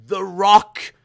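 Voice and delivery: loud, high "The Rock" announcement; hyped surprise reveal; excited reveal. Pretend. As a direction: blurt out the big reveal.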